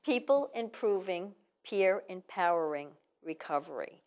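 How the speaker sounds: slow, word-by-word delivery; measured, with gaps between the words; said slowly. Shown as a demonstration. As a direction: spell out the name at half speed. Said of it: "Say it slow".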